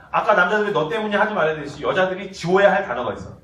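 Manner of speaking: ordinary delivery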